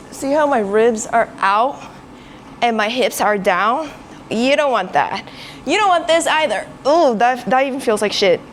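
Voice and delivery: loud, strained under the weight; lifting weights. Real, not acted. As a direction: push the words out while lifting. Talking mid-set while straining.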